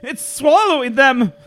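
loud, high, slow horrified cry; cried out. Acted. Tobias sees friends swallowed, loud horrified cry. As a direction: scream it out in horror. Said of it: "Tobias cried".

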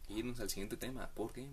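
ordinary delivery

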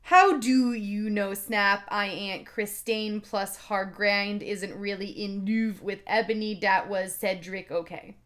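loud, slow, overblown delivery easing off at the end; exaggerated note reading. Author scolding readers in a note, hammed-up insistence. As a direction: sound out every misspelling with mock insistence.

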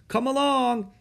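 high, loud call from afar. A narrator's reading. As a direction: call to people lagging down the hill. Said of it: "Eric called from farther up the hill".

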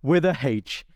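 high, slow mock-vent, hitting the name hard; friend venting. Friend venting to the host about his rare name, mock-exasperated. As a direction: vent it high and drawn out, like a wronged man. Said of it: "he reeled them off. He goes".

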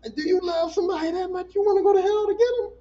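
high, loud cry of disbelief; half-sobbing, shouting at the screen; tearful outcry. Retold or imitated. Viewer overwhelmed by the husband's sacrifice, crying out high and loud. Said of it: "And I was just like".

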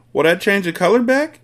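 high, worried question; anxious. A narrator's reading. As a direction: ask it nervously as the desperate father. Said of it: "the father asked anxiously".

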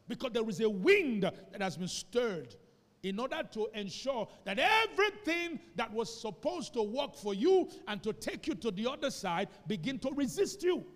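preaching. Acted. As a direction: drive it home to the congregation. Preaching climax.

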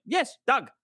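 high mock fixer reply; imitating the fixer. Fixer confirms.

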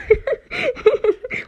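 high, loud giggles; real giggling fit. Real, not acted. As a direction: keep cracking up, helplessly. Still laughing at own demo.

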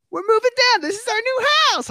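high, loud mock wife announcing. Wife announcing the new house, high and gleeful. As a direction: squeal it like big news.

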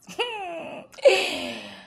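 high, falling half-laughing wail; playful fake sob; mock whimper. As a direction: pretend to cry over it, drawn out. Mock distress at first kiss.

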